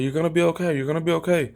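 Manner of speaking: high-pitched girlfriend imitation; imitated reassuring girlfriend. Girlfriend comforting him in the shower, imitated reassurance.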